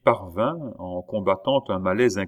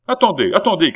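higher and louder call to stop; crying out; 9 semitones higher, 9 dB louder, faster than usual. A narrator's reading. Baron calling his daughter back, loud. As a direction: stop her at the door. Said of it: "cria-t-il".

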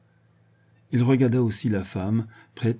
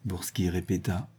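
plain reading voice

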